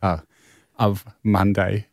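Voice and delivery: ordinary delivery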